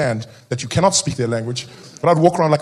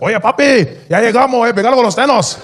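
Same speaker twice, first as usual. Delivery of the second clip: higher, louder Spanish greeting; 6 semitones higher, 3 dB louder than usual. Retold or imitated. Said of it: "He's like".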